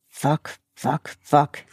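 ordinary delivery, nothing marked

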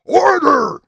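very loud, high single shout; yelling at full force. A narrator's reading. Sheriff silencing the wild courtroom, full-force yell. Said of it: "the sheriff yelling".

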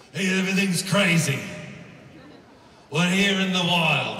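hammed-up wilderness presenter; bad attempt at the outdoor adventurer; Bear Grylls. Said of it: "I can't do his voice".

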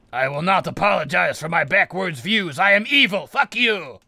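loud, high-pitched evil outburst; defiant villain rant. Pretend. Evil sorcerer refusing to apologize for his views, defiant villain rant. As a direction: declare it defiantly, building to a shout.

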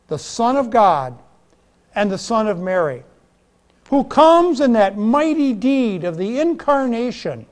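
ordinary talk

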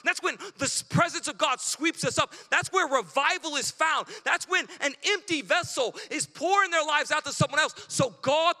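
loud, high preaching at full force; fervent sermon peak; preaching climax. Acted. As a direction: build the congregation up.

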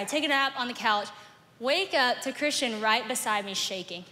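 ordinary delivery

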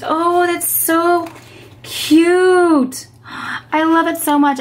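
overjoyed squealing. Real, not acted. Opening a Kate Spade coin purse from her friend, gasping high squeals. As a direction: gasp and squeal like the best gift ever. Unboxing a surprise birthday gift.

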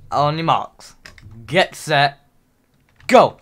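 loud countdown, building to a shouted go; race start call. Real, not acted. Starting the round for Luna, loud race-start shout.

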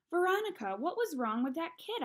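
plain reading voice

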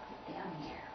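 ordinary delivery, nothing marked

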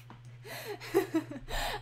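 high giggle she tries to hold back; guilty laugh. Real, not acted. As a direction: laugh despite yourself. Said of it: "I should not be laughing at this".

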